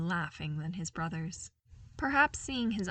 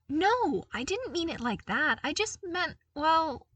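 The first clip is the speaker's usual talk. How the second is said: flustered; 10 semitones higher, 5 dB louder than usual. A narrator's reading. Luke flustered backpedalling.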